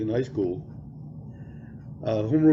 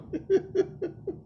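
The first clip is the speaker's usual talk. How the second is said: a high hooting laugh; 5 dB quieter than usual. Retold or imitated. Mock teacher laugh.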